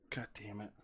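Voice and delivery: usual speaking voice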